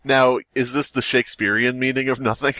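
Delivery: ordinary talk, nothing marked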